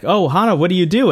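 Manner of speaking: mock excited. Retelling character meeting Hana.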